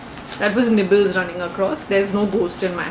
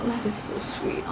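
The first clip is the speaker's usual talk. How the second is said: whispering; 3 semitones lower, 12 dB quieter than usual. Real, not acted. Touched by the note.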